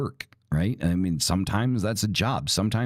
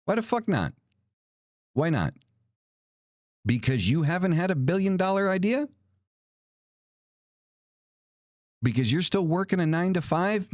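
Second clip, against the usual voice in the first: much higher, heated, incredulous; indignant rant; 9 semitones higher than usual. Real, not acted. Vlogger ranting at people jealous of billionaires, heated and high. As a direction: fire rhetorical questions at them, worked up.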